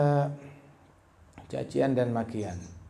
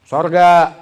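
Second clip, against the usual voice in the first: shouted; 3 semitones higher, 16 dB louder than usual. Real, not acted. Preacher bursts out with heaven.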